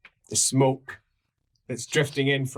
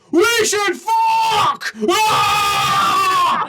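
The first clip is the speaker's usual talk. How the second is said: very high, loud slow panicked yell; a guard panicking at the ogre attack, yelling; panicked shout; 9 dB louder, much slower than usual. Acted. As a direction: yell in panic as it all collapses.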